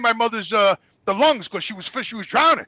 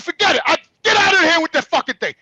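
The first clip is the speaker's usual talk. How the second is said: higher, heated order to leave; angry dismissal; 6 semitones higher, 3 dB louder than usual. Retold or imitated. Son sending the nurses away, angry. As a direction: throw them out angrily.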